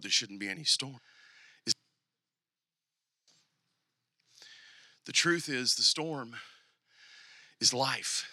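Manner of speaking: low, soft sermon voice with long pauses; quiet, weighty pastoral delivery; reflective preaching. Real, not acted. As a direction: bring it down to a hushed, earnest appeal.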